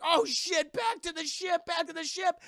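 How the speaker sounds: high, loud panicked yell; alarmed shout. Retold or imitated. Sailors yelling to rush back to the ship, panicked shout. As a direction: call the crew back in a panic.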